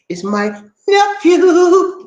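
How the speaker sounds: high, loud, long-drawn NEPHEW; triumphant high whoop on the last word; proud reveal. Real, not acted. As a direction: crow it out slowly, stretching "nephew". Big reveal of the new chief.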